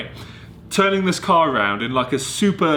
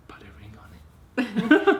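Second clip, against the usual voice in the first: slow teasing whisper, higher; 8 semitones higher, slower than usual. Real, not acted. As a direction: whisper it slowly like a cheeky secret. Playful hint to partner.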